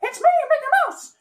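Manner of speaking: high squeaky cheerful mouse; Mickey Mouse impression. Shown as a demonstration. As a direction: chirp it like the cartoon mouse. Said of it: "not the most professional impression".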